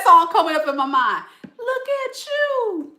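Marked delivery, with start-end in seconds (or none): 1.4-2.9 high, far-off mocking coo; teasing taunt. To the angry ex, a high mocking coo. Teasing the person who's mad.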